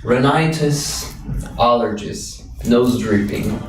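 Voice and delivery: ordinary talk, nothing marked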